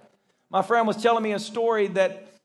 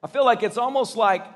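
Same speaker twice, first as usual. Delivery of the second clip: normal talking voice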